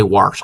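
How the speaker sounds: WASH said as "warsh"; Maryland pronunciation. Shown as a demonstration. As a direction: add an R to wash. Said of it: "it's very popular to say".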